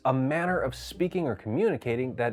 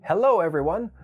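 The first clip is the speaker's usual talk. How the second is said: a bright, louder standard American hello; standard American greeting; 6 semitones higher, 7 dB louder than usual. Shown as a demonstration. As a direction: greet the table in plain American English. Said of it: "the standard American greeting of".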